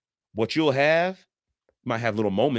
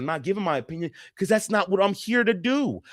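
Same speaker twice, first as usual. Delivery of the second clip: ordinary talk, nothing marked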